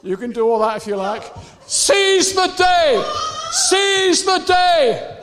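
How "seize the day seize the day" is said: loud, high, slow battle cry; shouted exhortation. Real, not acted. Preacher urging Alison to seize the day, shouted exhortation. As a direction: shout it slowly across the room.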